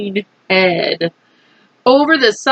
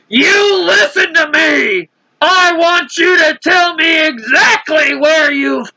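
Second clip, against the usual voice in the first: high, slow commanding shout; police chief shouting orders at the centipede; 6 semitones higher than usual. A narrator's reading. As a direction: demand an answer from below. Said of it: "shouted the chief of police".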